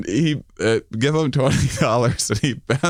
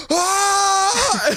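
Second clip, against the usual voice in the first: retold yelp of pain. Nick with a dart in his backside yelps in a sharp high cry. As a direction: yelp as if a dart just hit. Nick shot with three darts.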